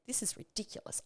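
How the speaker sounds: muttering to herself. Retold or imitated. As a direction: grumble it to yourself while busy. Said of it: "the whole way through going".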